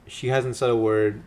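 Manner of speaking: ordinary talk, nothing marked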